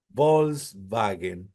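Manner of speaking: Volkswagen said very slowly, with a V sound for the W; German pronunciation. Shown as a demonstration. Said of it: "cómo ustedes piensan que la pronuncian la W los alemanes".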